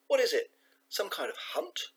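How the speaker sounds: high-pitched, demanding questions; a mum demanding to know, voice pitched right up. A narrator's reading. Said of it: "demanded Bee's mum".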